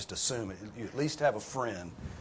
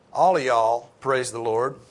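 "all of y'all" loud and stretched out; slow, loud call leaning on "all of y'all"; emphatic plural call; 10 dB louder than usual. Shown as a demonstration. Preacher explaining the plural you to everyone, emphatic "all of y'all". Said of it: "and it's emphatic".

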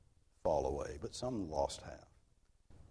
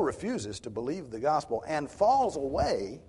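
loud, slow and higher, hammering each phrase; preaching at full force; 7 semitones higher, 8 dB louder than usual. Acted. Preacher to his congregation, pressing the warning, loud and slow. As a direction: preach it out to the back pews, slowly.

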